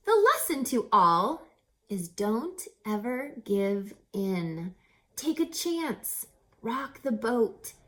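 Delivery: lively rhyming read-aloud with a bouncy lilt; storybook narration. A narrator's reading. Reader telling children a rhyming story, lively. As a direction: read it like a picture book to kids.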